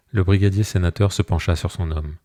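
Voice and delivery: ordinary delivery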